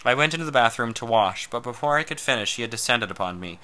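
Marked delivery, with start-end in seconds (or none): none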